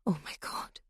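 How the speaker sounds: horrified breathless whisper; barely audible, frozen with fear; terrified whisper. Acted. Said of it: "Shell heard herself say".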